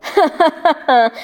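mocking laugh. A narrator's reading. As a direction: laugh at her with scorn. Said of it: "خندهٔ تمسخرآمیزی کرد".

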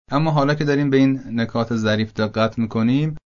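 ordinary talk

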